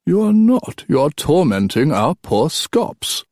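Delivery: high, drawn-out mock reproach; old king chiding. Old king catching his son not listening, chiding. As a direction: scold him slowly, pitch swooping up.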